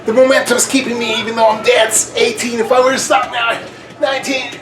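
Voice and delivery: high, strained gasping count; straining. Real, not acted. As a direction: count through burning muscles. Last reps, dead tired.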